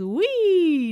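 high, loud squeal of fun; gleeful cry. A narrator's reading. Jessie spinning on the record, squealing with glee. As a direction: let out a thrilled whee. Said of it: "Jessie cried".

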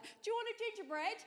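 high, hushed imitation of a yell; put-on accent, high and soft mock shout. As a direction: fake a distant call to a girlfriend, thin on volume. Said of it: "he suddenly yells to his".

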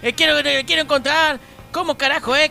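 loud, laughing frustration at a failed accent; frustrated outburst. Real, not acted. To his friends, loudly frustrated that his Paraguayan accent fails. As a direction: shout it out, giving up on the accent. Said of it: "no me sale el paraguayo".

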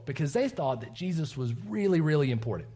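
ordinary talk, nothing marked

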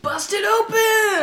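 high, slow, shouted cheer; excited shout. Real, not acted. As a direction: yell it out to egg them on. Player urging the GM on.

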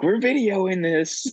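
high, slow, put-on voice; mocking nervous newcomers filming the neighbor; mock panicky Californian. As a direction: drag it out in a high worried voice. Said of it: "They were like".

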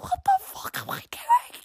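very high, whispery squeal; panicked inner voice. Retold or imitated. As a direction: squeak it softly in mock panic. Retold inner panic mid story.

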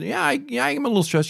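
high-pitched hesitant admission to the nanny; sheepish admission. Retold or imitated. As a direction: own up to it with a high little voice.

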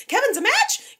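high, loud squeal of surprise, rising at the end; excited disbelief. Retold or imitated. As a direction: gasp it out as a thrilled question. Nurses celebrate Kevin as donor.

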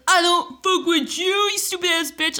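high, slow taunting yell; mock screamed taunt. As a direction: imitate him yelling the song at her. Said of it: "He's like".

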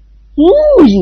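high whinnying cry like a horse. Acted. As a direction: neigh it out. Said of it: "นิกรร้องราวกับม้า".

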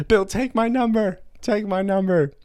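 high mock-girl plea; squealing girl fan. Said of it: "running up to Bill and being like".

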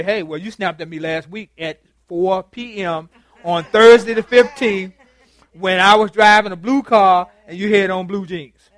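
mock grudge-keeper reciting old offences; petty accusing rant. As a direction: list every detail back at them, rising to a near shout. Voicing petty partner.

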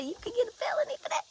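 stunned hushed whisper; shocked whisper. Retold or imitated. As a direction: breathe it out in disbelief. Shocked reaction to felony news.

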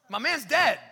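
high, loud comic protest; hammed-up loud objection. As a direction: blurt it out in mock disbelief. Sisters' protest acted out, after laugh.

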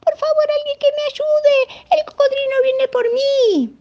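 very high, loud panicked cries; screaming for help. Acted. Monkey running from the crocodile, screaming for help in a high voice. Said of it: "empezó a correr gritando".

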